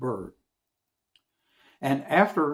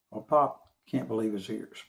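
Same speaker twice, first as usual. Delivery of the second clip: ordinary delivery, nothing marked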